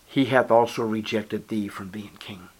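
whispered scripture; hushed verdict. Acted. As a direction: whisper the judgment. Preacher whispers the verdict verse.

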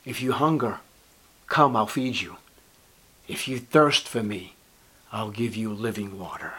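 soft, low gentle offer; coaxing the hungry to come; tender invitation. Acted. Tender invitation, softer, lower.

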